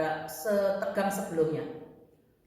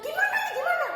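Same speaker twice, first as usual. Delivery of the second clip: very high, louder frantic cry; retold panicked shout; 5 dB louder than usual. Mother beside baby who stopped breathing, retold panicked shout. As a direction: re-enact a desperate yell for help. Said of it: "Saya teriak".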